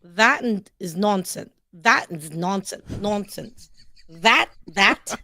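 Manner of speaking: put-on slow, careful go at a British accent; imitated British accent. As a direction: try out a British accent, deliberately. Imitates the British phrase just given.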